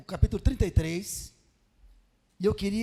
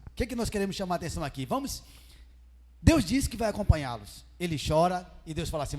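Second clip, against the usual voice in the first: usual speaking voice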